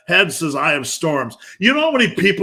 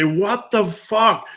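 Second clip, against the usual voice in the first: higher, slow, stretched-out grumble; groggy morning groan; 5 semitones higher, much slower than usual. Retold or imitated. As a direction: drag it out half awake on the edge of the bed. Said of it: "sit on the edge of the bed and say".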